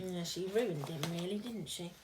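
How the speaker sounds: plain reading voice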